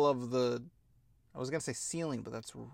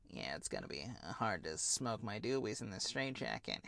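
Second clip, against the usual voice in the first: lazy stoner quote; 8 semitones lower, 14 dB quieter than usual. Retold or imitated. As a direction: mumble it like a stoned villain. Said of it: "He's like".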